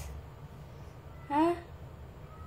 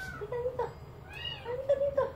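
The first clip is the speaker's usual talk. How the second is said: very high, soft coaxing squeak; high-pitched cooing summons; high pet coaxing; 8 dB quieter, much faster than usual. Real, not acted. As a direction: call a shy pet over in a tiny high voice.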